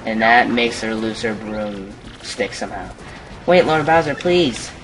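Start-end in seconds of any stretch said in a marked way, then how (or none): 3.5-4.7 high, loud mock plea; pleading henchwoman. Henchwoman begs her lord to wait, high pleading call. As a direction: call after the boss in a raised voice.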